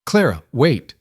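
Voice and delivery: mild call after someone leaving. A narrator's reading. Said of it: "she heard Harriet call out".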